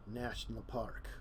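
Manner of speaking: ordinary delivery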